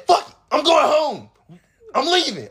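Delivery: high, loud mock blow-up; retold angry outburst. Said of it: "I got so mad".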